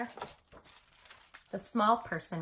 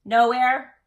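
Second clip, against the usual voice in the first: loud short dismissal; curt brush-off; 3 semitones higher, 12 dB louder than usual. A narrator's reading. Elmore brushing off his sibling, loud and curt. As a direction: shut the little one out. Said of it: "said Elmore".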